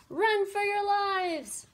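high, loud, drawn-out cry of alarm; slow, high storybook shout; panicked shout. A narrator's reading. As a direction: shout a warning to everyone fleeing. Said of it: "they shouted".

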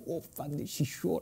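soft, under-the-breath exclamation; hushed awe. Acted. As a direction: marvel quietly, almost to yourself. Awed aside before gushing.

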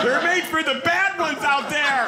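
high-pitched mock yell; comic outburst. Comedian protesting road strips to the crowd, high mock outburst. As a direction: blurt it out in a high, strained protest.

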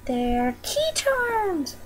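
high, very slow, stretched squeal; delighted reveal. Real, not acted. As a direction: draw out the surprise in a soft squeal.

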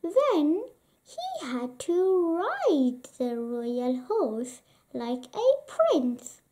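plain reading voice

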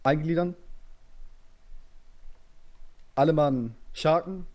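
calling orders to a line; barked drill commands. Real, not acted.

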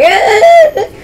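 high, loud mock cracked squawk; imitated voice crack. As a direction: mimic your own cracking voice. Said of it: "weirdest voice crack I've ever heard".

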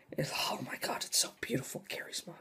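whispered in hushed awe; soft whispered awe over the game; awed whisper. Real, not acted. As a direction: breathe it out like something sacred. Awestruck over a bought game.

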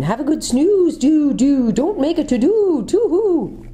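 a drowsy owl crooning them back to sleep; sleepy owl. A narrator's reading. The owl telling Jill to sleep, high hooting voice. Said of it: "said a sleepy voice".

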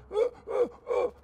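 high, repeated gasping; acted breathless whooping gulps; winded gasps. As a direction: imitate fighting for breath. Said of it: "I'm going".

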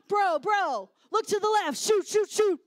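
put-on high, loud kid-in-battle shouts; imitating yelled commands. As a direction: bark urgent game commands like an excited player. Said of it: "Derek's yelling all these commands".

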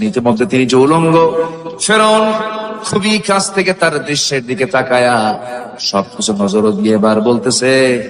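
melodic sermon chant on long held notes; সুরেলা ওয়াজ. A narrator's reading. Preacher chants the story melodically. (সুর করে টেনে টেনে ওয়াজের বয়ান)

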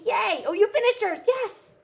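high, loud squeals of delight; super excited. Real, not acted. Crafting host cheers a viewer who finished her card, thrilled. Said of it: "now I'm super excited".

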